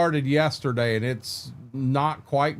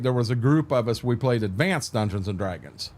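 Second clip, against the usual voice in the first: ordinary talk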